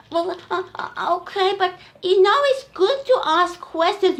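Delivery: high, timid protest; little elephant voice. Acted. Little elephant timidly objecting to Auntie Giraffe, high. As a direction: mumble a small objection like a calf.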